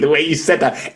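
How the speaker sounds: normal talking voice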